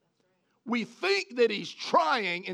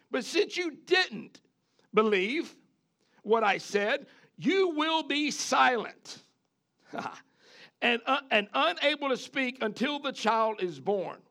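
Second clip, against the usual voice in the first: stern angel; 5 semitones higher than usual. Retold or imitated. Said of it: "the angel said".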